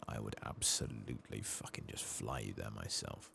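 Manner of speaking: normal talking voice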